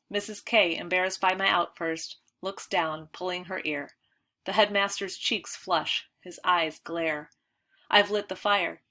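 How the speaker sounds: plain reading voice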